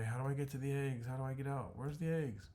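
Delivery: frantic. Acted. Jenny lost in burning tunnel.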